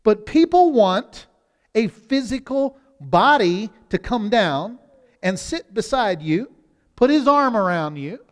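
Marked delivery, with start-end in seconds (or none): none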